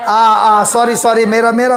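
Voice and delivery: ordinary delivery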